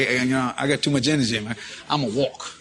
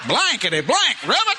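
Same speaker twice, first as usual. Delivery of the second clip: raised pitch, rising at the end; lightly hammed-up exasperation; mock startled cussing; 8 semitones higher than usual. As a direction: imitate a startled hunter cussing. Acting startled hunters cussing back.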